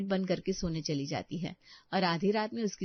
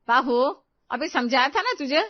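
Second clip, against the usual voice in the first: loud, sharp reproach; scolding mother-in-law; 6 semitones higher, 9 dB louder than usual. Acted. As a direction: call her out loudly, then scold. Mother-in-law catches and scolds her.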